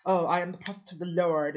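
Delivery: high, loud hammed-up proclamation; mock grandiose prophet. Mocking instant Christians with a loud hammed-up prophet voice. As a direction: announce yourself like a pompous prophet. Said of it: "It wasn't just like".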